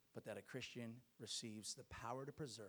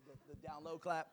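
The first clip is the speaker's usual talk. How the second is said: ordinary delivery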